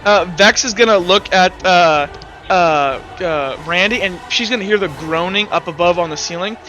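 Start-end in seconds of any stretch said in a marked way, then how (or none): none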